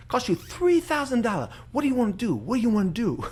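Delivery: a Filipino homily joke, lightly mocking; Filipino accent. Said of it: "Dude, your accents".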